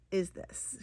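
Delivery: whispered, hushed with delight; breathy whisper. Real, not acted. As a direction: whisper it like a happy secret. Showing cute pattern, whispered.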